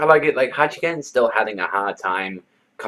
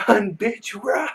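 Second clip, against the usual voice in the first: high strained scream, held back in volume; mock scream; 13 semitones higher, slower than usual. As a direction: imitate a crazed gambler screaming at a horse. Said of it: "he's, like, screaming".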